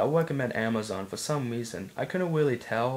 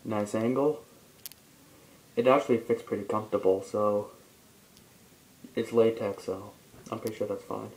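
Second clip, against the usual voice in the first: speaking through full head mask; muffled through a mask; 2 semitones lower than usual. Real, not acted. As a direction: voice inside latex mask. Seen 1: Speaker's head fully covered by horse mask throughout labelled words.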